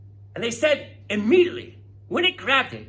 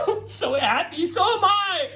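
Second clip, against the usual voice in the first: laughing; 8 semitones higher than usual. Real, not acted. Laughing joy at hatching video.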